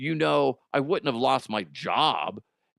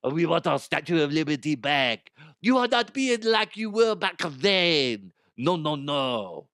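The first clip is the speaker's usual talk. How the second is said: wag a finger, lightly voiced; mock French complaint; 2 semitones higher than usual. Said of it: "mock France voice".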